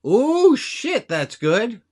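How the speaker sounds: high, loud exclamation, then settling; impressed whoop at a clever line; delighted surprise. Real, not acted. Host reacting to a simple technique, delighted surprise.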